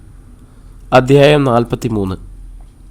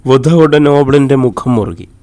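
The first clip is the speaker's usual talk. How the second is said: ordinary delivery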